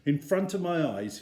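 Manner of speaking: ordinary delivery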